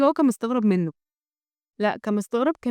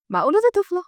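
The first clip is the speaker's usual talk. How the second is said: high, louder burst of disbelief; shocked disbelief; 9 semitones higher, 5 dB louder than usual. A narrator's reading. Michael stunned by the child's huge appetite, shocked disbelief. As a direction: ask it astonished, pitch leaping up. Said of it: "سبب صدمة كبيرة أوي لمايكل".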